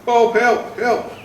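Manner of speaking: high, loud cry for Bob's help; mum shouting for help. Retold or imitated. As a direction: call out to Bob in a panic, retold. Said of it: "Mum shouting".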